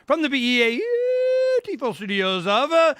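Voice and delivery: booming radio-announcer intro, high and loud; big hammed-up broadcast announcer. Shown as a demonstration. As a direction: belt it out like a station ID. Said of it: "Yep, that was better".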